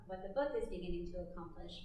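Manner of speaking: ordinary talk, nothing marked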